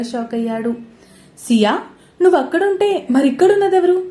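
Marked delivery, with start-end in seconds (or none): 1.5-4.0 high, loud, stunned asking; a loud shocked demand; shocked question. A narrator's reading. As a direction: blurt it out in disbelief. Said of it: "షాకింగ్గా అడిగాడు వైవిక్".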